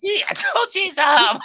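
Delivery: loud, high shout through laughter; teasing his friend, shouting between laughs; laughing shout. Real, not acted. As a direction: yell it while cracking up.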